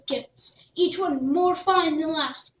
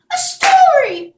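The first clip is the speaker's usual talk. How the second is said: loud, very high, slow squeal of joy; delighted; 13 dB louder, slower than usual. Acted. Princess thrilled by Jack's tale, loud high squeal. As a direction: clap and cry out in delight. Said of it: "clapped the princess".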